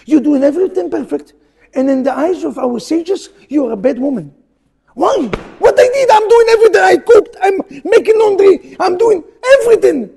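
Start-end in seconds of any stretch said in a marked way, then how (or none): 4.9-10.1 high, loud protesting wife; indignant wife. Retold or imitated. Rabbi voices the wife protesting to her husband, loud and high. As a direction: play the wife crying out that she does it all.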